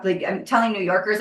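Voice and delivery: usual speaking voice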